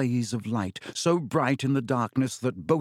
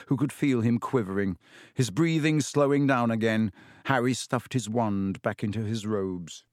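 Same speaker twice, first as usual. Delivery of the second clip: ordinary delivery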